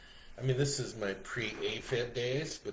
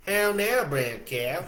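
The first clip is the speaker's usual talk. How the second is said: high, loud Southern brown cow; Southern accent; 7 semitones higher, 8 dB louder, slower than usual. Retold or imitated. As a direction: ham up the Southern drawl. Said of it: "like she's from the South".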